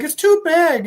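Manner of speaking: put-on high, loud kid's whine; complaining kid. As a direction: imitate a child complaining. Child complains suit is too big.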